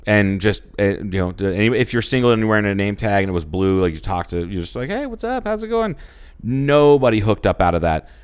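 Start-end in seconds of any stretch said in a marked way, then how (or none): 4.9-5.9 much higher, chirpy hello; mock bright flirt; flirty greeting. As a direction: chat up a stranger.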